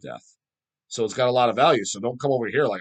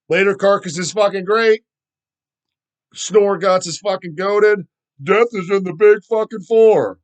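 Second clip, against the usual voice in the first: louder, higher, hammed-up fake enthusiasm; mock trendy opinions; 6 semitones higher, 8 dB louder than usual. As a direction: parrot the trend-hoppers' hot takes.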